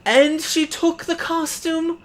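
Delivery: slow, high, wailing complaint; theater man lamenting the stolen costume, slow and high; despairing theater man. Acted. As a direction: stretch it out like a wounded diva. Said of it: "He says".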